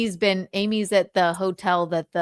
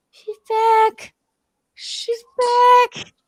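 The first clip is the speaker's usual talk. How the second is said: high, loud cheer; excited; 13 semitones higher, 5 dB louder, much slower than usual. Real, not acted. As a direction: cheer that she's back. Celebrating video call reconnecting.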